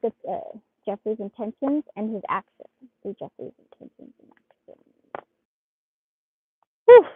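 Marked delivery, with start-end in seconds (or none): 3.0-4.4 a soft murmured instruction to the cards; whispered request. Real, not acted. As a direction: whisper "Do Jeffrey's intentions and actions".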